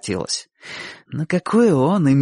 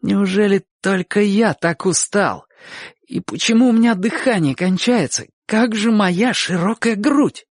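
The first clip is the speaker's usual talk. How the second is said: dismayed, losing heart; 8 semitones higher than usual. A narrator's reading. Chik tires mid-fight and thinks, bewildered dismay. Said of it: "чувствуя, что начинает теряться".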